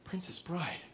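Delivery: hushed, unvoiced find; whispered discovery. Shopper spotting a favorite movie, whispering. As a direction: whisper it like a secret. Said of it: "you're like".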